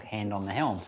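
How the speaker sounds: ordinary delivery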